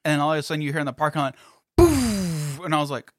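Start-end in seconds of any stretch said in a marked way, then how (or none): 1.8-2.6 a short, punchy "Boof!" for a blown speaker; mock boom. Telling coworkers the car blew, imitated boom. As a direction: blurt out the bang like an explosion. Car system blowing in lot.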